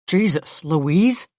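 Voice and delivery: a touch of startled exclamation; startled blurt. A narrator's reading. Man startled to find Louise in his office, blurting. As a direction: blurt it out, mildly shocked. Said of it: "he blurts".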